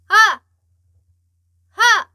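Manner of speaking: loud, high open "ah!" pushed from the belly; supported belly shout. Shown as a demonstration. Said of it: "이렇게 나가야 되지".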